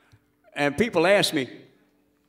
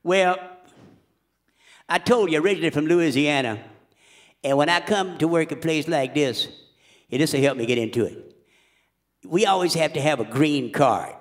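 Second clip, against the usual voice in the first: Louisiana bluesman voice. Acted. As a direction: talk it up as the bluesman. Holds bluesman character voice.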